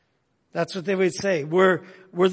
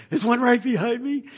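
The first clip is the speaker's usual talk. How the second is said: higher, teasing voice; mocking; 6 semitones higher than usual. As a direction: fake alarm, just teasing. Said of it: "making a mockery of it".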